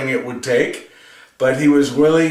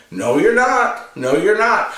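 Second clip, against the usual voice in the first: mild, higher-pitched knee-jerk refusal; lightly voiced Peter; 7 semitones higher than usual. Peter refusing to have his feet washed, lightly acted protest. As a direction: blurt out a quick protest, a touch indignant. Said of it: "He reacts before he thinks".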